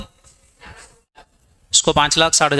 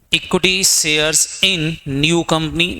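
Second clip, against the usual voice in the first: slow, word by word for note-taking; slow dictation; much slower than usual. Shown as a demonstration. Teacher dictates to students who are taking notes, slowly. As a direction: spell it out slowly so students can write. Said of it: "लिख लो यहाँ पर".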